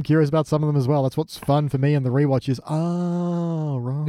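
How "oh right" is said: long, stretched "oh" falling away softly; drawn-out realization. Acted. Viewer catching on during a rewatch, drawn-out realization. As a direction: draw it out as if it just dawned.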